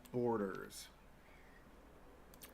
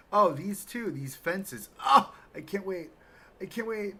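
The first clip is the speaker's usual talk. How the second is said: excited; 8 semitones higher, 9 dB louder than usual. Real, not acted. Gushing over fences.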